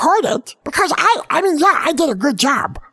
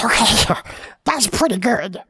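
ordinary talk, nothing marked

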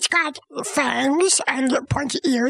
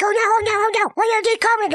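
high-pitched mock panic; panicked; 8 semitones higher, much faster than usual. Child acts out Scrooge dreading the ghosts, panicked. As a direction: play a frightened Scrooge, voice jumping up.

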